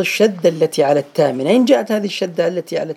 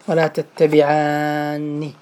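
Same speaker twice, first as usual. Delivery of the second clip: slow recitation; 2 semitones lower, much slower than usual. Shown as a demonstration. Teacher recites Quran example slowly.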